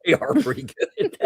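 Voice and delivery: high, giggling; laughing. Real, not acted. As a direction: laugh through the words. Laughing review.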